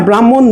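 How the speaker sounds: ব্রাহ্মণ as bram-mon, h left out; wrong pronunciation. Shown as a demonstration. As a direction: say ব্রাহ্মণ with a long m only. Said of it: "ব্রাহ্মণ না".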